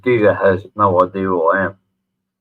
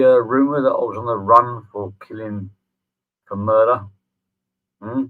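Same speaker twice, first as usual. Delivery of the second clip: usual speaking voice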